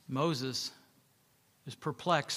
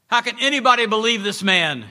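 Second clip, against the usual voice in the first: loud, higher angry outburst from the back of the crowd; shouting; 7 semitones higher, 8 dB louder than usual. Acted. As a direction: call out in disbelief. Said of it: "he shouted".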